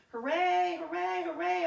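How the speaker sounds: loud, high cheer of a happy crowd. Acted. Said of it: "all the people cheered".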